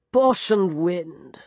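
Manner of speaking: slow, scornful dismissal; scoffing interruption. A narrator's reading. Geoffrey scoffs at the girl, slow and scornful. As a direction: brush her off with contempt. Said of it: "broke in Geoffrey".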